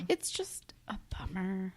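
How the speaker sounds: whispered and slow; deflated whisper. Real, not acted. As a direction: let it fade out, disappointed. Sad scene, speaker deflated.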